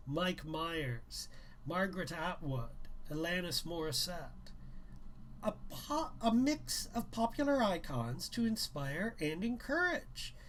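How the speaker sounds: reading a novel aloud, a little livelier; brisk read-aloud narration; story narration. A narrator's reading. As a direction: tell the story to listeners. Reads novel aloud to viewers.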